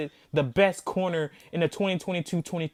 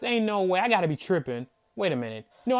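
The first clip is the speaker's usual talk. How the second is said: usual speaking voice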